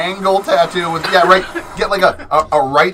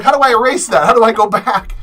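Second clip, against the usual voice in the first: higher, louder mock panic; flustered mom; 6 semitones higher, 7 dB louder than usual. Mom begging tattooist son to undo her squiggle, mock-panicked. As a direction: play up a beginner's alarm at a mistake. Re-enacting mom's panicked question.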